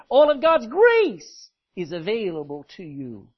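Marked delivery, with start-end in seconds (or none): 0.1-1.5 grace hit loud and drawn out; loud, deliberate phrase driving home to grace; stress on GRACE. Shown as a demonstration. Preacher showing where the emphasis goes, stress on grace. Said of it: "Put the emphasis on it".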